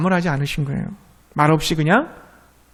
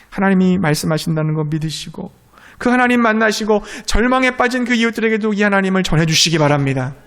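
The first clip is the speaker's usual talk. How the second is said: ordinary talk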